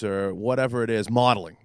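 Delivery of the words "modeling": modeling said with a thick Boston accent. Real, not acted. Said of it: "With my Boston accent".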